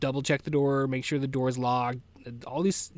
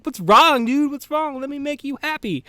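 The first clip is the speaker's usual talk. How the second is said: high-pitched pet voice, eager to comfort; squeaky, pleading doggy voice; voicing a concerned dog; 12 semitones higher, 6 dB louder than usual. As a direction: imitate a fussing dog way up high. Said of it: "and he's just like".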